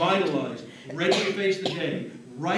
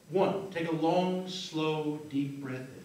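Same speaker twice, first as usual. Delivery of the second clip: soft, measured hypnotist voice with long pauses; hypnotic count-out; 3 semitones lower, 5 dB quieter than usual. Real, not acted. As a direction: guide them gently awake, sinking softer. Hypnotist counts participants out of trance.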